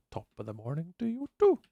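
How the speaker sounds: light put-on Irish accent on "Top of the morning to you too"; mock Irish accent. Shown as a demonstration. Streamer answering a viewer's Irish greeting in mock Irish. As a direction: tease the greeting in a hint of Irish. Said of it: "that's normally what the Irish people say".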